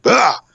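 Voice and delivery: imitated superhero shout; mimicked pose cry. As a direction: let out a quick striking-pose yell. Imitating Onita's Ultraman pose cry.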